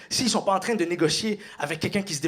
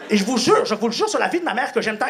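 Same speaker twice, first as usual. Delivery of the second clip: ordinary talk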